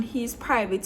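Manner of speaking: ordinary talk, nothing marked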